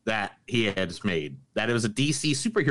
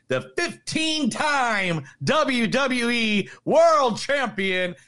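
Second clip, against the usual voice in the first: booming ring announcer intro; 13 semitones higher, 3 dB louder, much slower than usual. Pretend. Announcer-style wrestling introduction.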